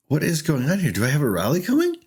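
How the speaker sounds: animated retold confusion; baffled. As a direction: act out not knowing what is happening. Retells own bafflement mid-game.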